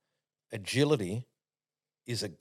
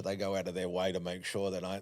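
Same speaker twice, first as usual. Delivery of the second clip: ordinary talk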